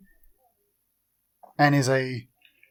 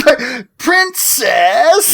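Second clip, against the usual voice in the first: Link cartoon voice; 8 semitones higher, 3 dB louder, much slower than usual. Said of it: "he sounded exactly like, uh, Link from the Zelda cartoon".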